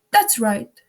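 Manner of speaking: loud shouted approval; shouting. A narrator's reading. Queen approves the soldiers' reply, loud shout. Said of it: "shouted the queen".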